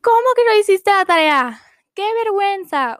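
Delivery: put-on shrill, loud, high-pitched teacher scolding a child. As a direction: play the angry teacher who humiliates the child in front of everyone. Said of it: "que te gritan".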